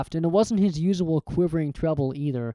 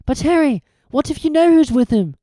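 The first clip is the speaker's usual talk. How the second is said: high, loud worried plea; frightened Hermione; 11 semitones higher, 8 dB louder than usual. A narrator's reading. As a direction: beg him to think.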